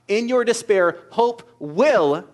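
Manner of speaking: loud, high proclamation, last words slowed; fervent preaching. Acted. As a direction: cry it out over the congregation. Preaching climax.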